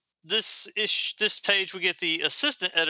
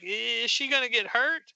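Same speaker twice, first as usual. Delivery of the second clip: timid question in a higher pitch; a lightly voiced shy girl, higher and hesitant; shy one; 7 semitones higher than usual. Shy sister anxiously asking the others, higher and timid. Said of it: "the shy one is like".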